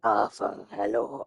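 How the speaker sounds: low attempt at an actor impression; Tom Hardy impression. Retrying the impression, low Tom Hardy voice. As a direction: drop into a half-hearted character greeting. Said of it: "I can do it better sometimes".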